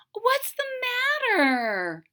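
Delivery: high, slow, concerned witch voice; Mama Witch. Acted. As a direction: ask gently like a worried witch mother. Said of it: "asked Mama Witch".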